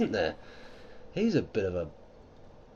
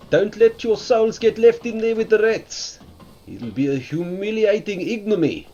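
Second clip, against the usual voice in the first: put-on a light South African accent, loud and pushed; half-hearted South African accent, warning the souls; 5 semitones higher, 6 dB louder than usual. Streamer warns about souls and rats, in a rough South African accent. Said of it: "my poor rendition of their accent".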